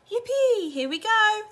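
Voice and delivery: high, loud whoop; gleeful yell; excited shout. A narrator's reading. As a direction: whoop with delight on the drop. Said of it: "Grandpa Joe shouted".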